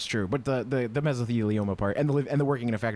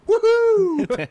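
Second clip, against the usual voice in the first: loud high whoop; excited cheer; 8 dB louder than usual. Pretend. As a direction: whoop with glee. Greedy sellers cheering.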